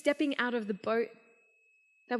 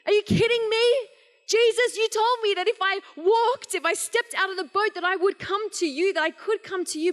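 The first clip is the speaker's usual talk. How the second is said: panicked cry, much higher and louder; freaking out; 8 semitones higher, 5 dB louder than usual. Retold or imitated. Preacher voices Peter sinking and crying out to Jesus, panicked yell. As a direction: yell it as you sink in panic. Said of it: "He freaked out".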